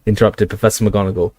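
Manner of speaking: usual speaking voice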